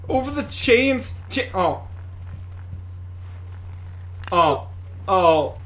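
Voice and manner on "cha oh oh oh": ordinary talk, nothing marked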